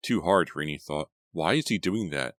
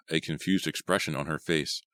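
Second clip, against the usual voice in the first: ordinary delivery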